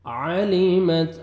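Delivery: very slow drawn-out melodic chant; slow Quranic recitation. Shown as a demonstration. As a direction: stretch every vowel like a qari.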